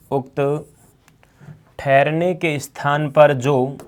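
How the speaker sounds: slow dictation in broken-up phrases. Acted. Teacher dictating notes to students, slow dictation. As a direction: read it out piece by piece for note-taking.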